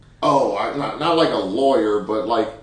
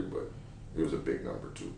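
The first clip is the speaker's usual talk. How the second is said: ordinary talk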